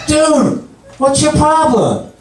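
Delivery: high, indignant demand; indignant challenge. Acted. To the naked stranger in the sauna, indignant. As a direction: challenge him, pitched right up in outrage.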